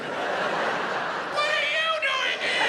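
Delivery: very high, loud, drawn-out mock shout; shocked yell. Surgeon bumping into him in the cafeteria, shrieking in shock. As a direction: shriek it in exaggerated surprise, stretching it out. Acted surprise meeting in the cafeteria.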